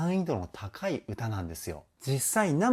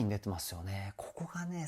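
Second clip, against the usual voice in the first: whisper; 5 semitones lower, 9 dB quieter than usual. Real, not acted. Awed aside after hearing song.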